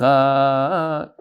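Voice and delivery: "Chag" held long on one note; emphasis on Chag. Shown as a demonstration. Teacher tests the student's version, "Chag" sung long. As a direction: stretch out the vowel of "Chag" and hold it. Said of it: "you put the emphasis on the Chag".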